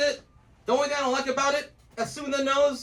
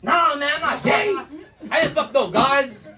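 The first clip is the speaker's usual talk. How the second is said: indignant denial; 3 semitones higher, 4 dB louder than usual. Retold or imitated. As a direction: protest it loudly, offended. Acted indignant denial by the friend.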